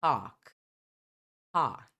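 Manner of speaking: British "Park" with silent r; non-rhotic British Park; British pronunciation. Shown as a demonstration. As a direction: say Park as "pahk". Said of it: "Já no americano".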